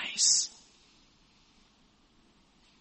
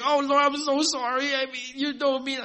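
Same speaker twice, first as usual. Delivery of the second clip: high, whiny mock apology; imitating a sorry believer begging the Lord; pleading believer; 8 semitones higher than usual. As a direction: grovel like a caught sinner. Believer pleading before God.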